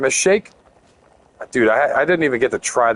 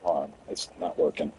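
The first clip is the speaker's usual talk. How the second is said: whispered mutter; 9 semitones lower, 11 dB quieter than usual. Real, not acted. Muttering about broken chat.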